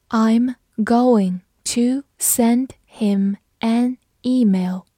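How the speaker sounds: very slow, word by word; slow read-through. Shown as a demonstration. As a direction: pause after every word as a teacher demonstrating. Teacher's slow read, then normal. (慢速逐词朗读)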